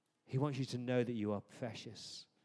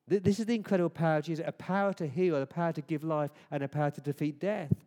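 louder, higher and fast, building proclamation; rousing preaching; 4 semitones higher, 7 dB louder, faster than usual. Acted. Preacher proclaims Jesus' power over death, rousing.

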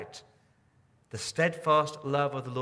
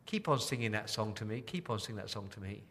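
put-on child's soft plea; 3 semitones lower, 8 dB quieter than usual. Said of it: "the child says".

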